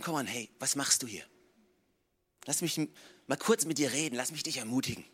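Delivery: God softly encouraging the tired prophet; gentle encouragement. Pretend. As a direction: coax him kindly.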